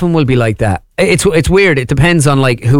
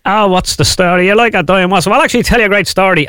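higher-pitched Dublin character impression; raised, chatty Dublin impression; Hutch; 6 semitones higher than usual. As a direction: put on a lively high Dublin voice. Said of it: "give me more of Hutch there".

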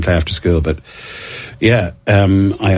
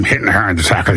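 ordinary talk

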